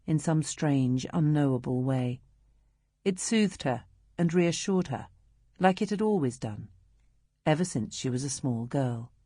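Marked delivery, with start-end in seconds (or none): none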